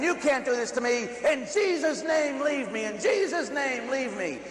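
high, frantic exorcism cry; stretched high cries of the holy name; retold scream. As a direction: plead and command in mock panic. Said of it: "And I'm screaming".

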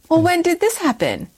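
high-pitched imitation of a stunned daughter; shocked disbelief. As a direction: demand an explanation, voice shooting up. Imitating mother's shock at grandmother's revelation.

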